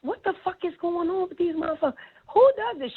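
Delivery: high, stunned disbelief; shocked at the grown women; incredulous. Retold or imitated. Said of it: "I'm like".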